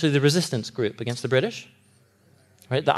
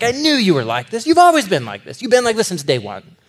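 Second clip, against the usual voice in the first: louder, higher accusing complaint; angry Jonah; 6 semitones higher, 4 dB louder than usual. Retold or imitated. Jonah throwing God's words back at him, hot and loud. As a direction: hurl the words back at God. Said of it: "so irrational and hot with anger".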